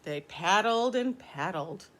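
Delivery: plain reading voice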